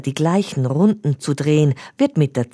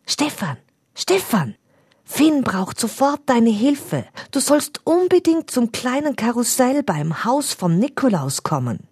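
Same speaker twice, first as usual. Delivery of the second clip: high, urgent fairy voice, not truly whispered; breathless hurry into a friend's ear; fairy urging a boy to hurry; excited whisper; 10 semitones higher than usual. A narrator's reading. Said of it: "flüstert ihm aufgeregt".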